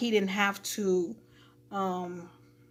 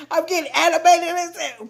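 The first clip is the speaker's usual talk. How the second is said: high, laughing aside; amused; 7 semitones higher, 4 dB louder than usual. Real, not acted. Storyteller catching herself getting carried away, amused. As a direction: say it through a real laugh. Laughs at her own animated retelling.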